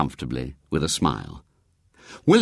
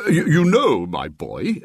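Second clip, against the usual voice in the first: louder, stammering start; mildly reproachful bishop; 6 semitones higher, 3 dB louder than usual. A narrator's reading. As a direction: reprove him gently. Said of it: "in mild reproach".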